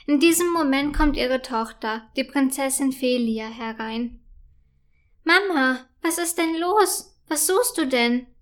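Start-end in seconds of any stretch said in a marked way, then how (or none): 5.2-8.3 concerned question to mother, raised pitch; a princess anxious for her mum, lightly voiced; worried daughter. Daughter asks her upset mother, mildly worried. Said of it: "fragt sie besorgt".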